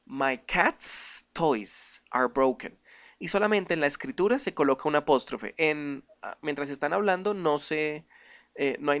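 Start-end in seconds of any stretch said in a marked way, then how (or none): 0.1-2.8 very slow, careful English sentence; slow English demo. Shown as a demonstration. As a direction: read it out slowly for the class. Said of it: "y se dice".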